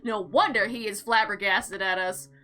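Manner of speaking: usual speaking voice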